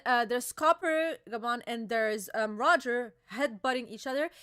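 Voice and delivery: ordinary talk, nothing marked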